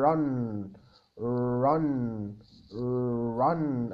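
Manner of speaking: teacher drills the English R in run; English R demo. Shown as a demonstration. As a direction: run said with the tongue curled back, mouth half closed. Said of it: "يطلع الساوند أحسن لما تقفل بقك شوية".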